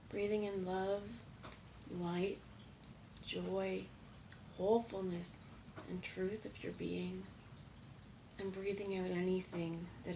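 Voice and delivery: calm meditation voice with long pauses; guided meditation. Real, not acted. Leading viewers through breath and chakras, calm and measured. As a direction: guide them gently through each breath, unhurried, leaving space between phrases.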